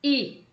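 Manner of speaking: i said alone, high and lifted; teacher isolates the first sound; isolated vowel. Shown as a demonstration. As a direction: pop the i of ilha on its own.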